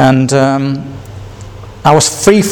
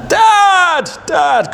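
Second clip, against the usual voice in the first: high, calling out for help; injured boy calling his dad to come; crying out for dad. Retold or imitated. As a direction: call for your father from the ground, high and urgent. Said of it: "And I just cried out".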